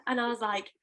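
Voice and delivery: usual speaking voice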